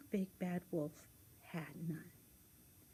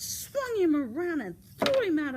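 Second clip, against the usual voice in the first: loud, high, effortful storytelling; vigorous, swooping storyteller; action-packed narration; 6 semitones higher, 9 dB louder than usual. A narrator's reading. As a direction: heave the words like a big throw. Narrating woodcutter hurling wolf.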